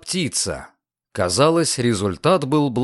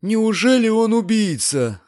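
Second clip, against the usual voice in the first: very high, appalled question; aghast landlady's high voice; horrified moan; 11 semitones higher than usual. A narrator's reading. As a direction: wail it in horror. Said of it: "снова простонала госпожа Бенсусон".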